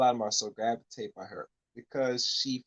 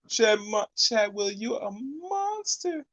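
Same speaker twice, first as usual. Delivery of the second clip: higher, very slow, weighty "monster"; drawn-out condemnation; 7 semitones higher, much slower than usual. Real, not acted. Cohost calling Chad Wheeler a monster, slow and emphatic. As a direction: stretch out "you a monster" to damn him.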